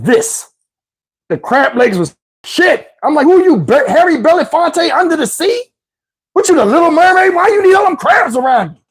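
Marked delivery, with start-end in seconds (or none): 3.2-8.8 mocking disbelief. Roasting the crab lady, high mocking shout. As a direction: sneer at her in disbelief, much higher.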